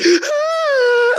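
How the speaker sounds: high squealing fake sob; a hammed-up falsetto wail; mock cry. [name]'s imagined cry, high squeal. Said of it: "And then he cried".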